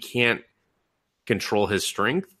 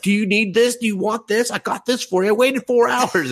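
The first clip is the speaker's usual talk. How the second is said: eager crowd of boys, voiced much higher and louder; fawning fanboys; 13 semitones higher, 5 dB louder than usual. Boys crowding the popular girl with gifts, fawning high voice. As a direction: squeal the offers like a crowd of fanboys.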